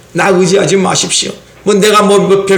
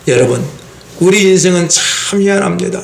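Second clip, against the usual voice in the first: normal talking voice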